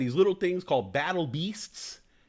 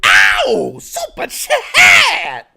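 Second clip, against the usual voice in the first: high, loud drawn-out yell; super chat shout; 13 dB louder, much slower than usual. Real, not acted. Streamer announcing a donation, loud stretched yell. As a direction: bellow the alert in a stretched cry.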